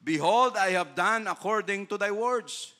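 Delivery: loud, solemn scripture quote; God's reply, read loud and grand. Acted. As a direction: proclaim it as God's answer. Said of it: "quotes God from scripture".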